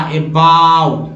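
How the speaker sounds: अबाउट said slowly with an आ-like vowel; slow model word. Shown as a demonstration. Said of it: "अबाउट में ओ का उच्चारण आ". (अबाउट धीरे से, आ की ध्वनि के साथ)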